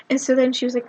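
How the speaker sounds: ordinary talk, nothing marked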